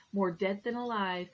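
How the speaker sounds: usual speaking voice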